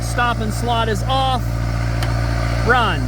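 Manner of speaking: loud, high, calling over engine noise. Real, not acted. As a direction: project over a roaring motor. Talking over a running engine.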